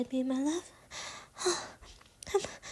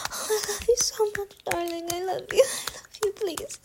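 sweet nothings; 6 semitones higher, 5 dB louder than usual. Acted. Lover murmuring endearments mid-scene.

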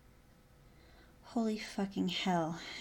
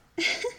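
quick bright giggle, up high; a playful little laugh; short high giggle; 5 dB louder than usual. Acted. Amused at his comment.

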